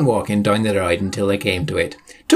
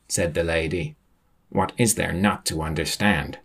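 plain reading voice

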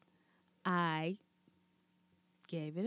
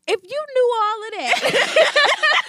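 high, loud, slow exasperated comeback; exasperated laugh; 14 semitones higher, 11 dB louder, slower than usual. Retold or imitated. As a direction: toss it back in disbelief, laughing. Retelling her answer to the nephew.

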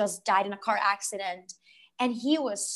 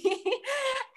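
high, teary laugh; tearful laugh; 4 dB quieter than usual. Real, not acted. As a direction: laugh on the edge of tears. Laughing, near tears.

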